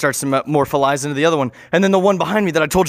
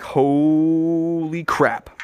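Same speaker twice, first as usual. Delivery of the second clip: long drawn-out amazement; mock awe, stretched out slowly; stunned; much slower than usual. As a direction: draw it out in disbelief. Stunned reaction acted in story.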